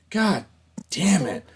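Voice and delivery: loud high curse, real annoyance; angry outburst. Real, not acted. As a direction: curse the botched take. Angry after a botched take.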